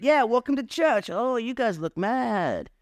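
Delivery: high, chirpy doorman welcome, slowing on the second line; breezy priest oblivious to the danger; clueless priest. Retold or imitated. Priest greeting six hundred armed men, high and oblivious.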